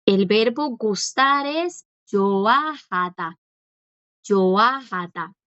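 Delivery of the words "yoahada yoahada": "yoahada" stretched syllable by syllable; slow pronunciation demo. Shown as a demonstration. Teacher models the verb gustar for students, slow pronunciation demo. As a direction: sound out "yoahada" slowly with a long middle vowel. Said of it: "igual no suena".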